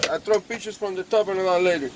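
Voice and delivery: ordinary talk, nothing marked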